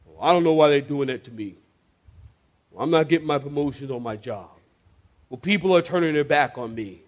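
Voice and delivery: lightly acted grumble, quick and low; complaining believer. Preacher imitating a believer under fire, complaining. Voices a complaining church member.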